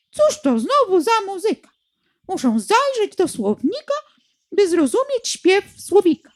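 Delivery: high, fussy chirping bird voice; sparrow voice. A narrator's reading. Sparrow mocking the nightingale, high and chirpy. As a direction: grumble like a cheeky sparrow. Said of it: "Na to wróbel zaterlikał".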